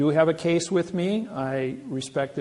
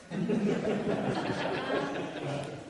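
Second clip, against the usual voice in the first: soft laugh; 7 semitones higher, 8 dB quieter than usual. Real, not acted. Laughs at story end.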